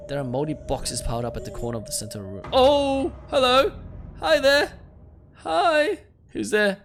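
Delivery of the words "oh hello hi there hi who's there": very high, loud falsetto greeting; nervous calling out. Acted. Player greeting whatever lurks in the basement, high loud falsetto. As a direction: call into the dark in a squeaky falsetto.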